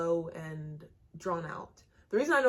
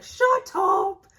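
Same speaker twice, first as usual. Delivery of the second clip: loud, high-pitched yell; retold shout; 13 semitones higher, 10 dB louder than usual. Retelling two big girls' outburst, high mock shout. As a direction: yell it like they did.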